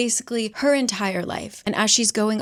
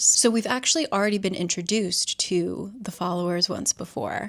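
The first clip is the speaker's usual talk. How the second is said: normal talking voice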